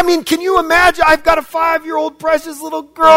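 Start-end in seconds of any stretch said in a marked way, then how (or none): none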